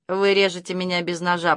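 ordinary delivery, nothing marked

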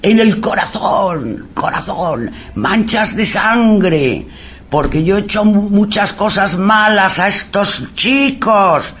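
high, slow, tormented confession; mock possessed wailing. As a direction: imitate a guilt-ridden soul wailing. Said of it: "[name] gritando esas barbaridades".

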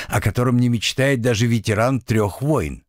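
plain reading voice

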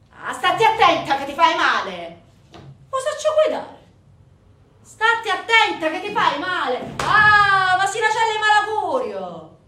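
high, very loud alarmed scolding; shouted warning. Acted. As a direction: yell after a reckless rider. Family shouting warnings at scooter rider.

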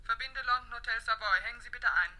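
high, distant switchboard voice; telephone operator. Acted. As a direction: confirm the call down the line. Operator connects a call to London.

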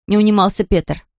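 ordinary delivery

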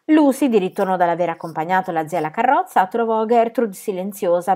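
usual speaking voice

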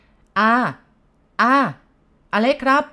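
put-on young man calls loudly from the street; calling out. A narrator's reading. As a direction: shout up to the house over the engine noise. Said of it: "เสียงตะโกนเรียก".

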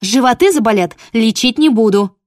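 loud, high stern warning; threatening. Acted. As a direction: threaten the dogs. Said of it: "пригрозила мама".

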